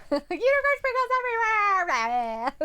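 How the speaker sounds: high, loud mock cry; mock excited. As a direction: wail it out in jest. Mock wailing joke.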